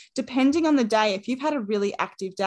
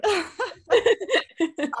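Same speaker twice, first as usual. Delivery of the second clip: delighted laugh; 10 semitones higher than usual. Real, not acted. Gushing over the new coach.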